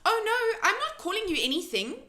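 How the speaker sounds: high, loud rushed protest; cried. A narrator's reading. Sophie hurrying to calm the hurt giant, crying out. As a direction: blurt it out, eager to make amends. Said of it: "Sophie cried".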